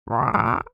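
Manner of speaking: low playful rawr; dog growl. Acted. Big dog answers.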